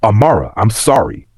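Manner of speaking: ordinary delivery, nothing marked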